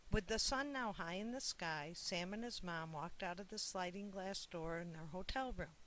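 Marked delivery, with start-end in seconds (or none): none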